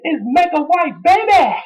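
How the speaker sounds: high mock taunt; The Rock impression. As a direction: sneer it in a squeaky voice. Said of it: "he was saying".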